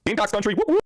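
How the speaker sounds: high-pitched team cheer; whooping cheer. Real, not acted. Host shouting out Gamecocks fans, high whooping cheer. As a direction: call it out high, ending in whoops.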